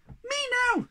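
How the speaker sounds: very high, eager squeal; high-pitched demanding squeak; squeaky Sniff. Acted. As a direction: squeak it out like an impatient little creature. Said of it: "squeaked Sniff".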